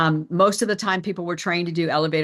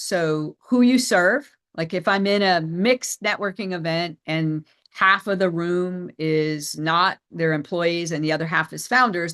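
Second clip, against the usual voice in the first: normal talking voice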